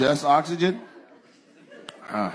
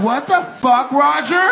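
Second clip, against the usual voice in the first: high, mock-indignant wife outburst; outraged wife; 10 semitones higher, 6 dB louder, slower than usual. Wife scolding Roger over being called broken, mock outraged. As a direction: pitch it up sharply on the husband's name. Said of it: "She said".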